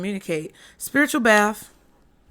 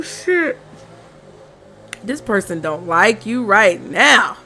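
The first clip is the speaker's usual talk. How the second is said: ordinary talk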